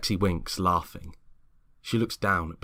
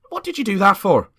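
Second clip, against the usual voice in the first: much higher, louder indignant cry; outraged at the sudden slap; shocked outburst; 11 dB louder than usual. Acted. Lexi protesting James knocking Greg's hand, shocked.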